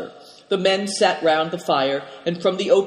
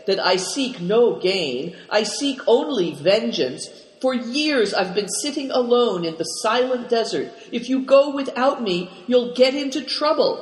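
pleading; 6 semitones higher than usual. A narrator's reading. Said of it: "Tolis resorted to pleading".

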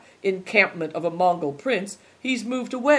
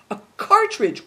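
louder and higher, thrilled at the find; excited cry; 6 semitones higher, 6 dB louder than usual. A narrator's reading. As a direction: blurt it out, amazed.

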